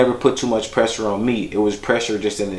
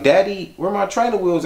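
put-on much higher voice of a puzzled child; young son; 11 semitones higher than usual. Son asking Daddy about missing training wheels, high child's voice. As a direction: imitate your little boy asking, pitched way up. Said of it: "I remember he was like".

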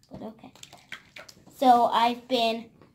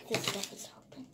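whisper; 3 semitones lower, 9 dB quieter, faster than usual. Real, not acted. Kid opening slime quietly.

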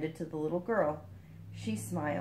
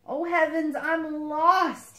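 higher, louder mock moan; 9 semitones higher, 7 dB louder, much slower than usual. A narrator's reading. Lost frog despairing in the garden, hammed-up moan. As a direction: wail at being lost, storybook style. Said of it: "he moaned".

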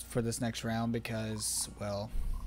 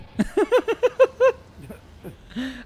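high laugh; 10 dB louder than usual. Real, not acted. Gamer laughing on stream.

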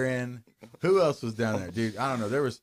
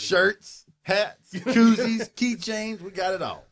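hammed-up merch pitch, higher and louder; mock salesman; 7 semitones higher, 4 dB louder, much slower than usual. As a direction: sell the junk like a carnival barker.

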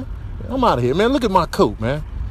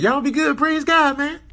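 high raccoon voice; 12 semitones higher than usual. Retold or imitated. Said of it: "Then he ran off saying".

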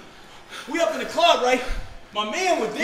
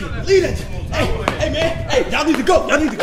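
panicked; 8 dB louder than usual. Acted. Panicked arrival, urging friends.

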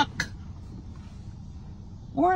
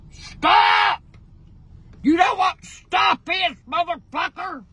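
angry shouting; 4 semitones higher than usual. Real, not acted. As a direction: yell at him, enraged. Grandma yelling at car thief.

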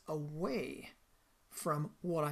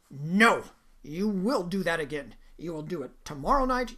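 much louder, forceful commanding boss; furious order; 10 dB louder than usual. Retold or imitated. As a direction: lay down the law at full volume. Furious boss overrules star.

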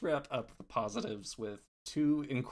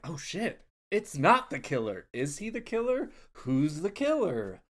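loud, raised-pitch replay of surprise; mock shocked reaction; 5 semitones higher, 7 dB louder than usual. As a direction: act out a baffled viewer, voice climbing. Acting out first-viewing reaction to the twist.

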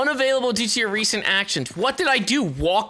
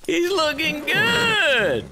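high, stretched-out admiring holler; hyped cheering; 8 semitones higher, much slower than usual. Real, not acted. Player hyping up his character, hyped cheering. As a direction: draw it out high and slow.

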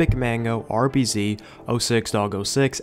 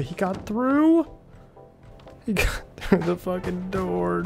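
shocked disbelief; 6 semitones higher than usual. Real, not acted. Enemy breaks through door.

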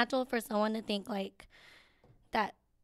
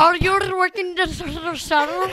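louder, higher, slow rolled R's; rolled R accent; 7 semitones higher, 13 dB louder, slower than usual. Retold or imitated. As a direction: roll the R's hard through it. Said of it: "She's like".

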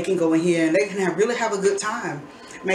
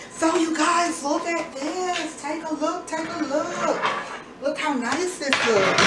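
excited showing off; 8 semitones higher, slower than usual. Real, not acted. Showing off the fresh pizza.

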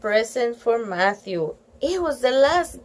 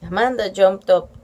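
ordinary delivery

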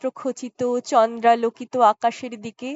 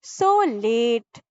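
lightly voiced jailer. A narrator's reading. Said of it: "হেসে ঘড়ির দিকে তাকিয়ে বলতেন".

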